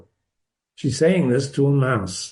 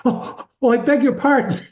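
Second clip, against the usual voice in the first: higher, flustered oh and apology; startled apology; 7 semitones higher than usual. A narrator's reading. Girl suddenly remembering she's talking to a mouse, startled apology. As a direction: catch yourself and apologize in a higher voice.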